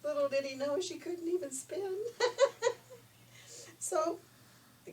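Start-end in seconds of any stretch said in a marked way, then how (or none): 2.0-3.0 a short, high, loud laugh. Real, not acted. Narrator laughs at the story's ending, high laugh. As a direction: burst out laughing at the prince's mistake.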